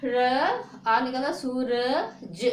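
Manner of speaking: Suraj said very slowly, sound by sound; teacher breaking the word into sounds. Shown as a demonstration. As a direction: stretch Suraj out for the class. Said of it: "अब देखो यहाँ पे सू र".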